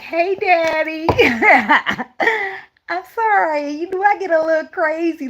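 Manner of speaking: put-on high, slow sugary baby voice; sweet-talking my man. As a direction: coo it up high to win him back. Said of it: "I be calling my man back like".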